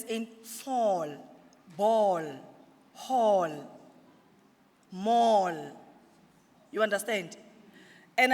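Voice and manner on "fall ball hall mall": fall, ball, hall, mall as separate words, vowel held; vowel sound demo. Shown as a demonstration. Teacher giving examples of a letter sound, words demonstrated one by one. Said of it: "for instance".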